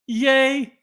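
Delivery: a fake hooray at bad news; sarcastic cheer. Clerk finds the boots are an eight, high sarcastic yay. Ironic cheer at wrong size.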